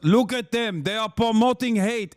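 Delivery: lightly hammed Israeli accent, accusing; mock Israeli envoy. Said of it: "because he goes".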